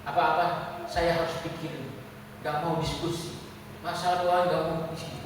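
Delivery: ordinary talk, nothing marked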